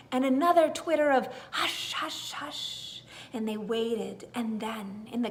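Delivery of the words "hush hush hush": breathy unvoiced hushing; whispered shush. A narrator's reading. Garden critters hushing each other in whispers.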